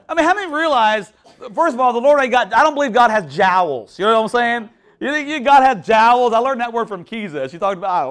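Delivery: ordinary delivery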